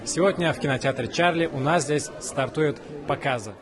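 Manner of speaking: ordinary talk, nothing marked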